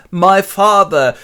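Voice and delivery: high, loud, drawn-out cry; dramatic exclamation. Acted. John kneels before his father, loud dramatic cry. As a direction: proclaim it slowly and dramatically.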